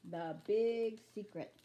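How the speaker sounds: usual speaking voice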